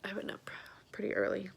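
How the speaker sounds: sleepy, yawning through words; tired and yawning. Real, not acted. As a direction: yawn through it. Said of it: "apologize for the yawning".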